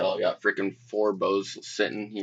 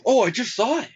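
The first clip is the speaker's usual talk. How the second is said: surprised; 13 semitones higher, 3 dB louder, faster than usual. Pretend. Jokey fake surprise.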